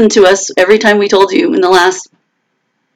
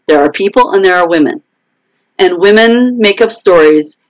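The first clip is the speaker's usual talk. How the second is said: ordinary talk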